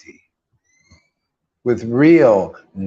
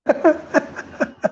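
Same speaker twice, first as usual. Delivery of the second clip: airy laugh; 14 semitones higher than usual. Real, not acted. Laughs at reading forever.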